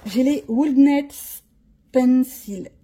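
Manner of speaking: slow, careful English accent on "Woodnets Pencil"; a clumsy attempt at an English accent. Shown as a demonstration. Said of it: "Ah, l'accent fait peur".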